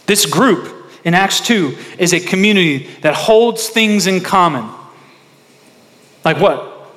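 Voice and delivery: high, loud, fervent sermon delivery; preaching at full force. Acted. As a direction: preach it out to the congregation.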